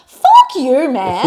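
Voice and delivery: loud, high "fuck you"; angry outburst. Real, not acted. Looking back at her jealous boss, loud angry outburst. Present self tells off boss.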